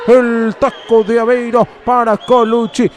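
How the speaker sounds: ordinary talk, nothing marked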